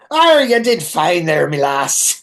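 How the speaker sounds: slow, hammed-up stage Irish brogue; mock Irish like a cereal-box leprechaun; bad Irish accent. Mocking Gere's line to the dying woman in a bad Irish accent. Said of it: "like, Lucky Charms ass accent".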